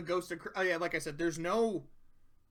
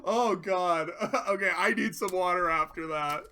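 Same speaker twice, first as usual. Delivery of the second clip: ordinary talk, nothing marked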